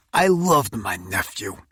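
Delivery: ordinary delivery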